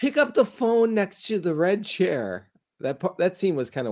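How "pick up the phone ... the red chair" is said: slow, higher-pitched quirky lady impression; crazy lady voice. Imitating the lady who calls about the phone, crazy voice. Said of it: "that lady with the crazy voice".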